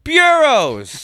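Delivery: loud, high, triumphant cry; excited shout. Real, not acted. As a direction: blurt it out like a eureka. Excited shout of the found word.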